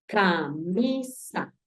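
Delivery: imitating a teacher, slowly syllabifying; mock teacher stretching ca-mi-sa; slow syllable-by-syllable. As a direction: spell it out slowly like a primary teacher. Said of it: "La profe nos decía".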